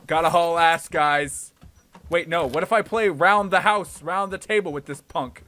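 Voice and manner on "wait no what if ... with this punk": ordinary talk, nothing marked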